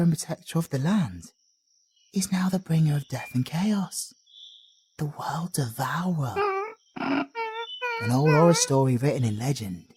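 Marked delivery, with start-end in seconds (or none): 2.1-9.8 dark storyteller's whisper, slow and hushed; hushed ominous telling. Acted. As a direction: let the dread creep in, breathing the words. Ominous legend of the fallen hunter.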